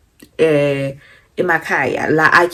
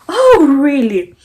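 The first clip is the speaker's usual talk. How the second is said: mock surprise; 7 semitones higher, 5 dB louder, slower than usual. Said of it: "I'm like".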